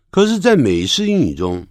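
normal talking voice

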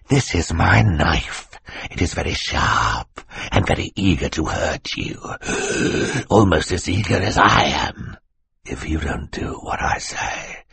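hushed, hissing villain; menacing threat. Acted. Villain threatens children with knife.

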